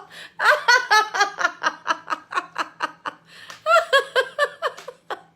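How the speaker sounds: loud, high laugh that keeps rolling; long laughing fit. Real, not acted. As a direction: lose it laughing. Keeps laughing at Rachel's comment.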